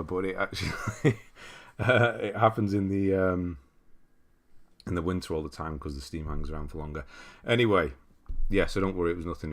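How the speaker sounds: ordinary talk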